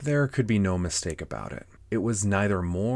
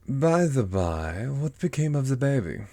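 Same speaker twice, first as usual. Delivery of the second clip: Cheshire Cat; 6 semitones higher, 4 dB louder than usual. Acted. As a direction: ask offhandedly as a storybook cat, stretching the first words. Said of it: "said the cat".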